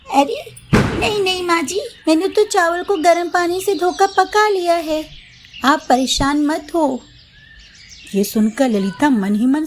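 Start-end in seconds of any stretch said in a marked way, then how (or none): 0.1-7.0 higher, sweet obedient bahu; high young daughter-in-law promising obedience. Acted. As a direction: sound eager to please mother-in-law. Mamta refuses help again.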